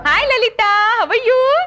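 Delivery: high, sing-out phone hello; gushing greeting. Acted. Clerk greeting her friend on the phone, gushing. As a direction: rise high and stretch the last word.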